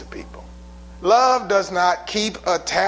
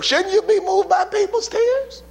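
much higher, pleading question; high incredulous question; 12 semitones higher than usual. Real, not acted. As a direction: ask it up high, almost pleading. Pitch jumps very high.

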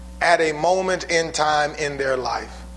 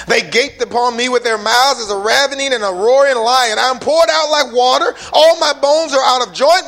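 fervent psalm reading; 7 semitones higher, 6 dB louder than usual. Acted. Preaching the psalm at full force.

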